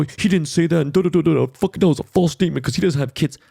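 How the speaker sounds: sneering imitation of Twitter critics; mocking critics. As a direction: parody the know-it-alls who corrected him. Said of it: "these fucking guys saying".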